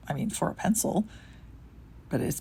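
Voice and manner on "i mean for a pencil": ordinary delivery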